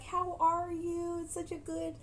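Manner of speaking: put-on boyfriend's mother greeting the girlfriend sweetly; mock sweet mother. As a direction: greet her like a polite mom. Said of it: "This woman's like".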